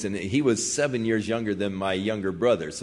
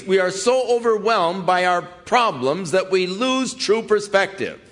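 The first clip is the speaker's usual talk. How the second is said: preaching; 9 semitones higher than usual. Acted. Preacher applies the lesson.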